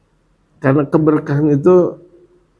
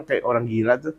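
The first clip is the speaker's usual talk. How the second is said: muttered gossip; 4 semitones lower, 10 dB quieter than usual. Retold or imitated. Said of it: "sampai orang bilang".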